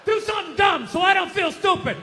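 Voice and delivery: yelled high and loud; high shout. Acted. Comic shouting a desperate plea to the audience. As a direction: holler it at the crowd.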